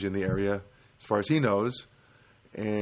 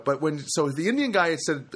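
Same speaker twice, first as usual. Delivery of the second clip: ordinary delivery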